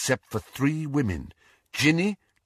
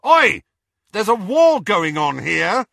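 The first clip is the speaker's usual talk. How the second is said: much higher, loud shout; raised voice; 8 semitones higher, 7 dB louder than usual. A narrator's reading. Harry shouting at the kissing couple, loud. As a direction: yell to break them apart. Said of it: "he raised his voice".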